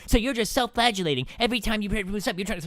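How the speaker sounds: mocking the opponent. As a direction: parody his condescending jab. Mocking the Naked Ape guy.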